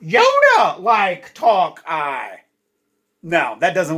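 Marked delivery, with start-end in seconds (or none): 0.0-2.4 slow, loud Yoda voice, words dragged out; hammed-up Yoda read, slow and loud; mock Yoda backwards. Shown as a demonstration. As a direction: drawl it like a bad Yoda. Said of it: "Let's try it out".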